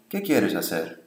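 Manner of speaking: low, falling tune on "¿Qué quieres hacer?"; no question intonation. Shown as a demonstration. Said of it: "intonation is not used in information questions".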